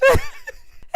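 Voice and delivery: a sudden high squeal of a laugh; high burst of laughter. Real, not acted. Recapper cracks up at Jasmine's situation, high laugh. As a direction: let a high laugh break out. Overwhelmed by the drama.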